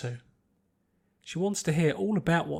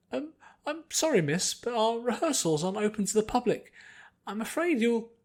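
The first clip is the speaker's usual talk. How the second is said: polite refusal; 7 semitones higher, 3 dB louder than usual. Acted. Leader declines politely.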